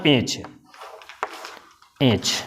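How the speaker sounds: "एच" said low and soft; demo letter h. Shown as a demonstration. Teacher reading the letter "एच" low and soft. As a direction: spell "एच" slowly, low and quiet. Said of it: "एक और ले लेते example".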